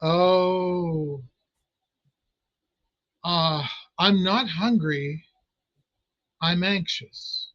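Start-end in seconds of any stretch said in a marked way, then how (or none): none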